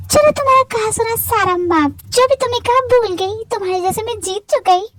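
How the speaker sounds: put-on high, gleeful young winner's voice; elated winner. Winner teasing the sulking loved one after the competition, high and gleeful. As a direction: crow over the win in a high voice.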